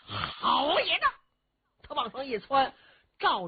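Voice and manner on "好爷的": brash cry. Acted. Cheng Yaojin charges again.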